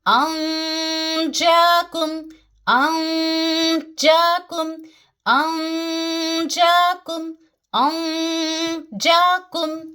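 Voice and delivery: अंजाकुम chanted slowly, the nasal held without touching the tongue; ikhfa nasal hold. Shown as a demonstration. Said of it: "जबान को खींच के रखेंगे".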